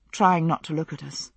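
ordinary delivery, nothing marked

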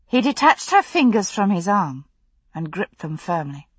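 usual speaking voice

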